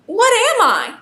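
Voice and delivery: high-pitched questioning little shape; lightly voiced shape. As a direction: ask it high, like a puzzled storybook shape. Shape asks who it is.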